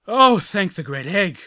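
loud, high, slow relieved cry; relieved blurt. A narrator's reading. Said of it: "one of them blurted out".

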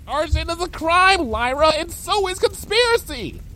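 high-pitched frantic scolding; shrill-pitched outrage; alarmed scolding. Acted. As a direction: scold in rising panic.

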